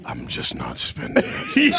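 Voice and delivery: very low, slow rumble; Vin. Retold or imitated. Comedian imitating frugal co-star, very low slow voice. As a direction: sink the voice right down, unhurried. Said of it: "everything about me wearing jeans".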